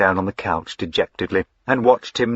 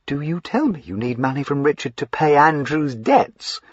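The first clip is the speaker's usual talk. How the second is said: higher, disbelieving question; incredulous; 7 semitones higher than usual. Acted. Lavinia questioning her brother, frankly incredulous. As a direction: ask it as if you can't believe it. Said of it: "frankly incredulous".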